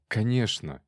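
ordinary delivery, nothing marked

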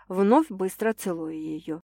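plain reading voice

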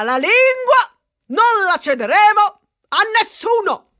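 high, loud rallying cry; defiant rally climax; rousing declaration. Acted. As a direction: finish the speech like a rally. Rousing end of the speech.

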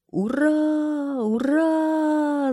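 very high, loud, long-held hurrah; cheering shout. A narrator's reading. Townspeople cheering the return, jubilant shouting. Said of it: "закричали все".

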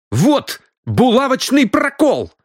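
high, slow, emphatic exclamation; exclaiming discovery. Acted. Сандал shows the pinhole in the card, exclaiming. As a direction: announce the proof high and drawn out. Man shows proof of cheating.

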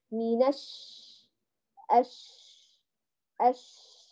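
long airy sh ending الش; held shhh on الش; spreading shin. Shown as a demonstration. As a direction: let the ش of الش spread and flow. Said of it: "سامعين هذا الصوت اللي هو مثل التفشي".

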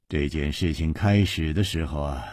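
low, subdued start to an explanation; sighing. A narrator's reading. Hero starts explaining to his friends, with a sigh. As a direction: begin it quietly, as after a sigh. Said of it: "叹了一口气".

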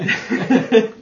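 bursting out laughing; a short loud giggle; loud high laugh. Real, not acted. Laugh after question.

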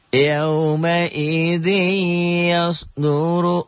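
slow, high chanted tilawah; Quran recitation. Shown as a demonstration. Teacher reciting the verse for the class, slow chant. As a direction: draw the words out melodically.

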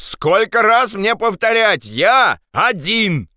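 exasperated high shout, drawn out. Acted. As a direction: call it slowly, at the end of patience. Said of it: "крикнул Андрес". (раздражённый протяжный крик)

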